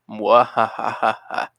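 low, slow villain cackle; unhinged evil laugh. Acted. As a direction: laugh like a scheming cartoon villain.

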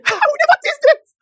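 squealing high and loud through a laugh; laughing exclamation. Real, not acted. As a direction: burst out in joyful laughter.

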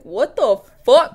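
loud, drawn-out indignant disbelief; upset outburst. Acted. Mocking people upset over a small donation, indignant. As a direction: stretch it out, loudly outraged. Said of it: "people would get upset".

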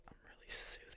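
very soft, soothing whisper; ASMR whisper. Shown as a demonstration. Podcaster trying out how to sound, ASMR whisper. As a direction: whisper it right into the mic like ASMR. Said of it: "No, that's A S M R".

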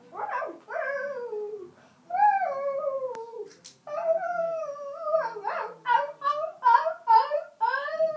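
high yappy dog barks; dog barking. Acted. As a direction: bark it like a small excited dog. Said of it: "The dog came by her bed and said".

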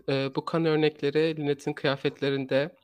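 ordinary talk, nothing marked

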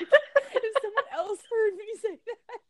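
giggling through the words; laughing while talking. Retold or imitated. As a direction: laugh it out helplessly. Laughing through a retold quote.

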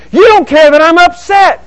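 loud, high-pitched outburst; upset spouse. Acted. Spouse in a quarrel, loudly upset. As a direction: hurl the hurt at your partner, high and loud.

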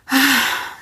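a long, weary exhale; loud heavy sigh. A narrator's reading. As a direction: heave a deflated sigh. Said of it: "Rose heaved a loud sigh".

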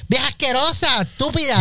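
high-pitched comic indignation; indignant insults. Pretend. Host insults the Comay.

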